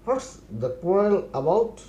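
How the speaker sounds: very slow, each word laid out for learners; slow model phrase. Shown as a demonstration. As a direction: spell it out slowly for the class. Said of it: "teacher repeats model phrase". (ধীরে ধীরে শেখানোর ভঙ্গিতে)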